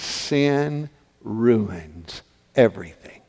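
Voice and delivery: slow, low, soft; grieving resignation. Acted. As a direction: weigh each word slowly and heavily. Joseph's last grieving thought.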